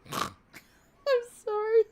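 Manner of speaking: high-pitched, cracking up; real helpless laughter; laughing apology. Real, not acted. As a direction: apologise through a laughing fit. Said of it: "I couldn't keep it together".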